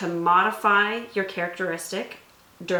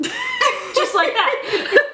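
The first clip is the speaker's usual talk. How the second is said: laughing; 4 dB louder, much slower than usual. Real, not acted. Laughing at friend's self-rating.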